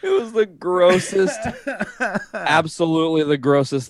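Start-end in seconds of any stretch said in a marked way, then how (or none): 0.0-1.4 higher and slow, still wheezing with laughter; barely recovered, still crying laughing; tearful laughter. Real, not acted. Summing up the story to a friend, still laughing.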